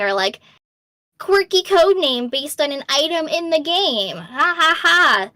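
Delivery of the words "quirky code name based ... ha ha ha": raised, playful mimicry ending in a fake laugh; mock-jolly imitation, higher than usual; mock quirky announcement. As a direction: pitch it up like a too-cute code name. Mimics companies' quirky code names.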